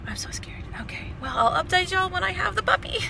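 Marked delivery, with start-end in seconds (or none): 0.1-0.6 whispered, nervous and hushed; scared whisper. Real, not acted. Nervous before meeting puppy. 0.8-1.4 whisper. Real, not acted. Hushed before meeting puppy.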